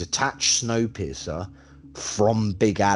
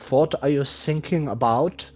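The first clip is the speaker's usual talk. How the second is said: put-on higher-pitched comic German accent; 7 semitones higher than usual. Host teases co-host after the Germanish remark, mock German accent. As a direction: ask it in a hammed-up German accent. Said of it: "That was [name]'s best German accent".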